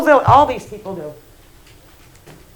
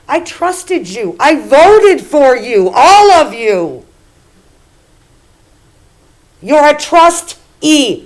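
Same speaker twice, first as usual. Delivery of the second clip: slow indignant; 3 semitones higher, 5 dB louder, slower than usual. Real, not acted. Resident confronting the board.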